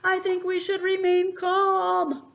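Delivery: high, loud, slow yell; yelling to stay calm. A narrator's reading. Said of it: "Goose yelled back".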